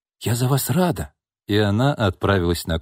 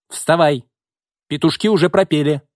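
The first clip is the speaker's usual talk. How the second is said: rousing call; 7 semitones higher, 4 dB louder than usual. A narrator's reading. Waking him with a shove.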